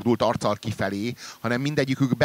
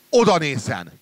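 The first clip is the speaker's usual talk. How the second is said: loud call to look; louder alarmed cry; urgent call; 4 semitones higher, 6 dB louder than usual. Acted. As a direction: shout to catch his attention. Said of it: "kiáltottam".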